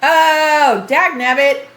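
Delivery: normal talking voice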